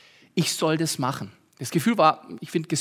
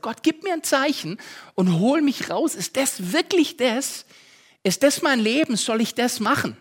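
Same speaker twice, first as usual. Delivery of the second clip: higher pleading prayer; mock cry to God; 6 semitones higher than usual. Pastor retelling his prayer, mildly pleading. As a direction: plead upward, half-acted. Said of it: "geschrien habe zu Gott".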